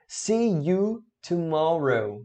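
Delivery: slow, drawn-out model; slow model phrase. Shown as a demonstration. Teacher modeling "see you tomorrow" slowly for students. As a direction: stretch each word of "See you tomorrow". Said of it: "Tomorrow é amanhã".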